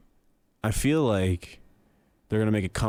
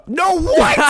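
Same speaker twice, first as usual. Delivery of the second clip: very high, loud incredulous yelp; shocked disbelief; 9 dB louder than usual. Real, not acted. Reacting to a friend's Pangea claim, shocked yelp. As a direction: shoot up in pitch with sheer disbelief.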